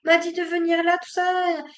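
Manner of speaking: put-on high, fast little boy asking his mom; young son's voice. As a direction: play the puzzled son. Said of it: "il y avait mon fils qui disait".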